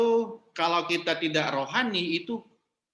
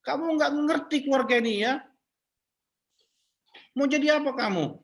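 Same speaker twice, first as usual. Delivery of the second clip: high, sharp scolding of a late husband; scolding the husband for coming home late, angry and high; angry scolding wife; 10 semitones higher than usual. Shown as a demonstration. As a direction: snap at him like a furious wife. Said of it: "langsung semprot suaminya".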